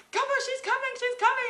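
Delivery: high, loud encouraging cry; excited cheering. Retold or imitated. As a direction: cheer her on at the finish. Staff cheering during birth.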